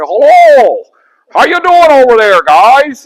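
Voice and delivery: high, loud mocking Yankee holler; mock Yankee accent. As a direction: call across the lines in an exaggerated Northern accent. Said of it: "Southerners also made fun of their accent".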